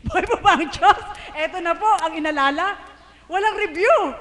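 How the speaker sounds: joyful shouted testimony; laughing loud praise. Real, not acted. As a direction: cheer it out to the hall. Laughing call to congregation.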